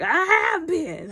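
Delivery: high, loud snap back; mock sharp retort. Father snapping at his son, loud. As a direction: fire it back. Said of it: "He's like".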